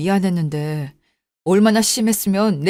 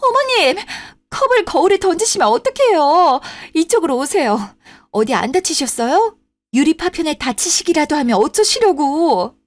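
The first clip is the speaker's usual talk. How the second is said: shocked, much higher voice, rising into alarm; alarmed cry; 7 semitones higher than usual. Acted. Daughter-in-law to mother-in-law after a cup hits the mirror, shocked and alarmed. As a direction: gasp it out as glass shatters.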